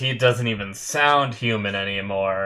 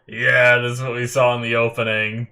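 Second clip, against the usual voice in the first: normal talking voice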